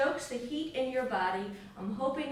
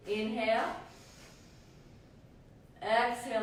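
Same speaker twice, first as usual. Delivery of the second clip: breath coaching cues. Acted. Leads breath of fire rounds.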